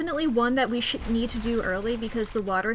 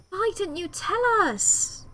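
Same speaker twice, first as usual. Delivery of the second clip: slow, high-pitched accusing question; hammed-up hurt disbelief; acted reproach; 7 semitones higher, slower than usual. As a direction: draw out a dismayed question to a friend. Character reproaching Pete.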